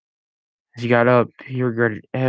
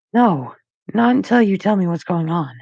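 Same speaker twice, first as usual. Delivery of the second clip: higher voice, firm and insistent; stern teen boy; 8 semitones higher, 3 dB louder than usual. A narrator's reading. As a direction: refuse him, defiant. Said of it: "Max said sternly".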